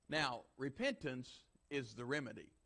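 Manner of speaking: normal talking voice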